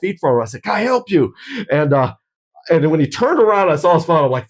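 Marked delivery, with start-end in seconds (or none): 0.6-1.3 higher-pitched, pointed challenge; retold confrontational call; loud challenge. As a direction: call out firmly, not quite angry. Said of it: "kind of a loud, not necessarily angry voice".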